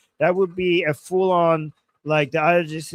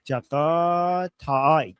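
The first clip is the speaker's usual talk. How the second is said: "Jakataik" drawn out syllable by syllable; careful, slow reading of "Jakataik"; slow sounding-out; much slower than usual. Real, not acted. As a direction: stretch the vowels of "Jakataik" very slowly. Sounding out an unfamiliar username.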